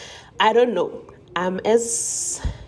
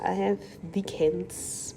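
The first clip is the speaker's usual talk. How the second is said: slow, soft whisper; hushed slow confession; 6 dB quieter than usual. Real, not acted. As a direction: confide it like a secret. Whispered joke.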